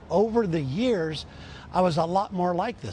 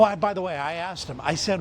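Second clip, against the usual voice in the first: ordinary talk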